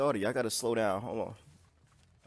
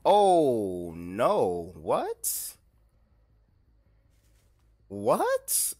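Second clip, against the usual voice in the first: shocked disbelief; 7 dB louder, much slower than usual. Real, not acted. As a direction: exclaim as if caught totally off guard. Survivor escapes unexpectedly.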